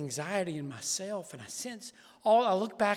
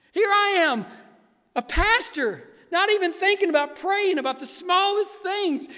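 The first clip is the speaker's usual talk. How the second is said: loud, high preaching with rising fervor; impassioned preaching; 12 semitones higher, 9 dB louder than usual. Acted. Pastor confessing to congregation, loud impassioned preaching. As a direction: preach it out, embarrassed and fired up.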